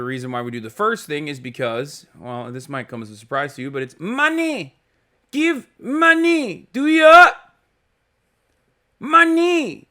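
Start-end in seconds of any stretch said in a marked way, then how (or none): 4.0-9.9 much higher, slow and drawn-out mock money chant; squeaky high meme voice crowing about money; Yilong Ma money bit. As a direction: ham up a meme voice, stretching each money. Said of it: "I can't stop doing the Yilong Ma money, uh, thing".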